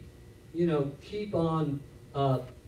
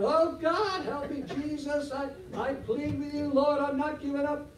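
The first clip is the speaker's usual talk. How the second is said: high-pitched, pleading mock prayer; desperate prayer; 10 semitones higher, 4 dB quieter than usual. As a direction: imitate someone begging God on the floor. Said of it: "they're crying out".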